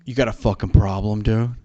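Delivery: loud challenge from a dude spoiling for a fight; mock a guy squaring up, loudly; confrontational dude. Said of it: "this dude just being like".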